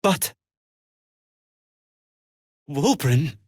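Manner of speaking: halting, broken-up disbelief; long pauses, voice catching on his friend's name; stunned grief. Acted. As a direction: fight to get the words out over the shock. Learns friend Wulbren has died.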